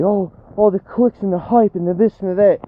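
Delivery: high, hammed-up mock of the critics; mocking mainstream chasers who complain about clicks and hype; mocking rival chasers. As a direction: parody their fussy complaints. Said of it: "These guys say".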